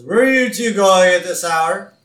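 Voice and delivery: loud, high mock questioning; Homer. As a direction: call out the question in a cartoon dad voice. Homer challenges the two in retold scene.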